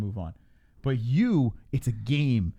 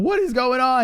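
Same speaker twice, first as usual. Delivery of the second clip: high incredulous exclamation; 13 semitones higher, 7 dB louder than usual. Retold or imitated. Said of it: "'Cause I'm like".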